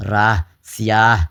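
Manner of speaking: slow راہ and سیاہ with the final "h" breathed out; teacher demonstrating the h ending of راہ and سیاہ; sounded final h. Shown as a demonstration. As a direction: hold the "aa" in راہ and سیاہ, then release an h. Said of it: "ادا کرتے وقت میں ہا جو ہے وہ تلفظ میں آ رہا".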